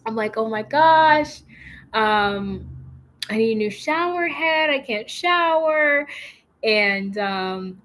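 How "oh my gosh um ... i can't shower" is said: mock panic. Retelling her panic at the weak shower, hammed-up and high. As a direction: act out freaking out over the broken shower. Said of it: "freaking out".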